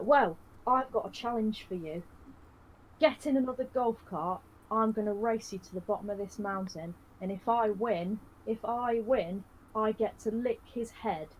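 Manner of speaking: a hint of a cocky rival daring you; hammed-up challenge. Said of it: "And he's like".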